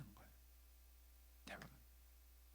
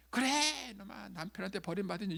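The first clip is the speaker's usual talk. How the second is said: loud, high outburst on the first word; imitated angry retort; 5 semitones higher, 6 dB louder than usual. Mother answering her protesting child, angry retort. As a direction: lash back like a furious mother. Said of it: "이렇게 나가는 거예요".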